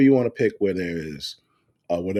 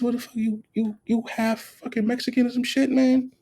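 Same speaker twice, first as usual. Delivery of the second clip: high, mock outraged ribbing; high teasing outrage at the ex-wife; incredulous teasing. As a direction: tease her in disbelief. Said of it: "I said".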